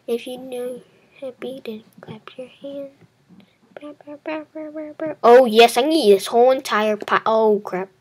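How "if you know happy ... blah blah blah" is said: unvoiced whisper, half sung; teasing whispered chant; whispered singsong. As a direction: breathe it out like a secret nursery rhyme. Whispers a kids' song mockingly.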